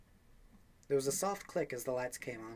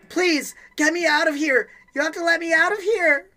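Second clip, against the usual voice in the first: much higher, much louder, pleading shriek; desperate scream; 16 dB louder than usual. Acted. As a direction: scream to be let out. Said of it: "She screamed at the door".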